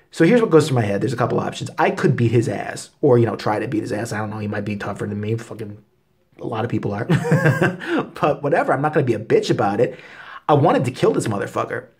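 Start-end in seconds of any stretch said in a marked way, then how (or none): none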